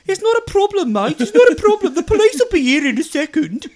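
high, loud mocking Birmingham accent; Brummie accent impression. The driver brushing off the bumped mirror in a Brummie accent. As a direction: ham up a smug Brummie telling him it's no problem. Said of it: "he goes".

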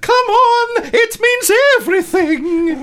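high, loud Scottish singing with rolled Rs; loud, high Scottish accent, Rs rolled. Pretend. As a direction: roll the R in right hard. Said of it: "rolling of the R's".

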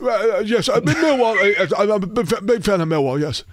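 put-on high-pitched, stammering old British man; doddering old Brit, high and halting; old guy British accent. Shown as a demonstration. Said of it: "That's my old guy British accent".

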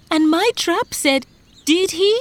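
high, loud panted outburst; worked-up fisherman, out of breath; panting. A narrator's reading. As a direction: gasp out your part urgently. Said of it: "The fisherman panted".

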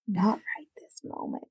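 whispered aside; a playful whispered refusal. Real, not acted. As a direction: drop to a breathy whisper as if sharing a secret. Joking aside about ice baths.

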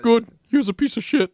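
high, put-on classmate voice; put-on impression voice. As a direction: blurt it in a high mocking impersonation. Keeps up the impersonation voice.

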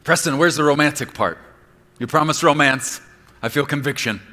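loud high teasing protest; mock complaint. Imagined listener complaining to preacher Preston, loud and teasing. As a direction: whine like a shortchanged listener.

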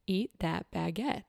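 soft, slow quoted command; hushed divine command. Retold or imitated. Retelling God's word about the baguette, hushed and slow. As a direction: say it slowly and quietly, with weight.

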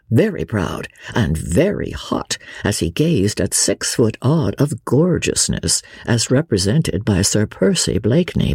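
ordinary delivery, nothing marked

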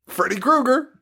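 high, hammy reveal breaking into laughter; Robert Englund. Acted. Mocking an actor who plays himself, hammed-up. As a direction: climb into a gleeful mock unmasking. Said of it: "it's still Robert Englund being like".

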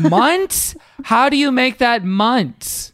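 high, loud disbelief; can't believe it, slowly; incredulous. Real, not acted. Incredulous reply to cup stacking.

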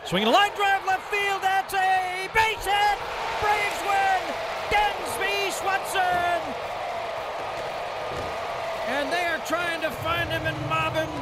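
high, ecstatic winning-hit shout; walk-off call. Acted. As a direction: stretch the name out in triumph.

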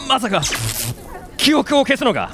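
a courier realizing his memory will be wiped; alarmed disbelief. Acted.

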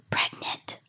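grandma's hushed whisper of a taboo word; old woman whispering. Retold or imitated. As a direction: breathe it like a scandalized grandma. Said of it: "Donna's grandma still whispers".

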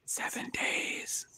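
hinted in a slow secret whisper. Real, not acted. Quizmaster slips the contestant a hint, whispering. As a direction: breathe the clue out, slow and unvoiced.

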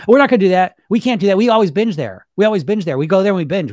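loud, high, insistent refusal; stubborn inner voice. Retold or imitated. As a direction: shout down every hope like a stubborn brain. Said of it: "your brain says".

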